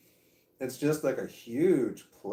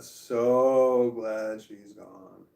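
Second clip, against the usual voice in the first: very slow, louder so glad; stretched out relief; 6 dB louder, much slower than usual. Real, not acted. Glad the daughter's mother is gone, very slow and louder. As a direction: savour every word of so glad. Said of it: "I'm laughing 'cause I'm glad".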